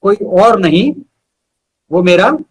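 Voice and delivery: measured, rhythmic recital with pauses between phrases; unhurried poetry reading, each line landing on its end; poem recitation. Acted. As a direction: recite it like a heartfelt ode to your best friend. Recites his friendship poem.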